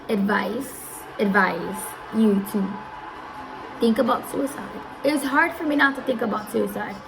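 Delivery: ordinary talk, nothing marked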